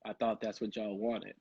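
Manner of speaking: quiet deadpan reply. Retold or imitated. Actor answering the director, deadpan. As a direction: drop it low and soft, dead-eyed. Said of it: "looked at him with dead eyes".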